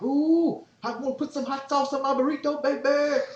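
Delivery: very high, loud falsetto squeal of a giddy dad; Craig's dad quote. Retold or imitated. Retelling Next Friday to his kid, Craig's dad in a high, loud falsetto. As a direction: squeal it way up like the movie dad craving hot sauce. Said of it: "he's like".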